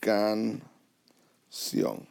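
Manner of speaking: "canción" slowly split as can... ción; divided into syllables. Shown as a demonstration. Teacher going back to the word, "canción" slowly split in two. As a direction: leave a long pause inside "canción", between its two syllables. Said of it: "we're gonna go back to that word".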